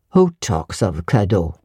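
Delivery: low, gruff master's retort; deep gruff master brushing off a present. A narrator's reading. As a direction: growl it down at the child. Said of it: "said he gruffly".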